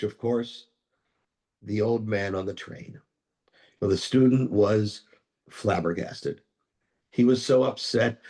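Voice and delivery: usual speaking voice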